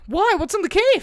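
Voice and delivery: high, loud, exasperated why; incredulous yell. Retold or imitated. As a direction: demand to know what's in there. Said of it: "And it's like".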